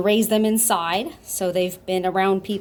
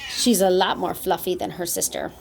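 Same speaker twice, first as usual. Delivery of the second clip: ordinary delivery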